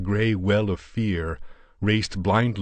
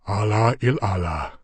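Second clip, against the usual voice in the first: pious; 3 dB louder than usual. Acted. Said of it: "said Yar Ali piously".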